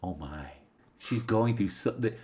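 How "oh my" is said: low, hushed little murmur; soft, lowered, dazed aside; overwhelmed. Retold or imitated. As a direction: imitate someone stunned, sinking into quiet. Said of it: "sits down and she's just like".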